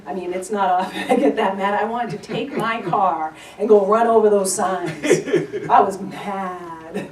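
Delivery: loud, laughing about her own fury; half-laugh while getting loud and mad; laughing anger. Real, not acted. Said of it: "And I got so enraged".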